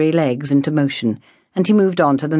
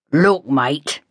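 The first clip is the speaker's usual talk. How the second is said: modified tone. A narrator's reading. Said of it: "Stranks modified his tone".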